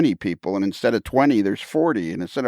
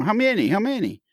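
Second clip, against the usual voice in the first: market seller; 11 semitones higher than usual. Retold or imitated. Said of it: "The guy's going".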